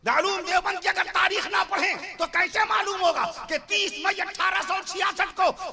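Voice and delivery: high, forceful sermon delivery; fiery preaching. Real, not acted. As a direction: drive each phrase home to the crowd. Preacher declaiming history at full force. (پرجوش خطیبانہ انداز، اونچی آواز)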